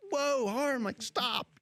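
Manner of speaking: high-pitched panicky plea to calm him; high, alarmed whoa, go easy. Retold or imitated. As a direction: squeak out a frightened please stop. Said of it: "And I'm like".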